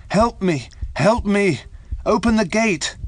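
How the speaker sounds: high, loud cries for help; calling out loudly. A narrator's reading. Dreaming man begs someone to open the gate, loud panicked cries. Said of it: "He was calling out loudly".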